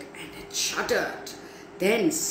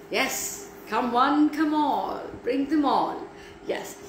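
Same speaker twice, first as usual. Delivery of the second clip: ordinary delivery, nothing marked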